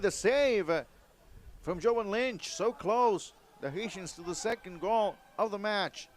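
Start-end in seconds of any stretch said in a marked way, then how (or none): 0.0-3.3 excited save call. Real, not acted.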